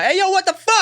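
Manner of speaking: high, loud startled yell; mock outraged dad. Future dad practising catching his kid, mock outrage. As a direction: burst out in pretend outrage. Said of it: "I should practice my".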